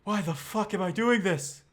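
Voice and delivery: high, loud and fed up; a winded mount cursing its lot; exasperated panting horse. Acted. Munker the horse groans to himself, exasperated. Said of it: "he's like".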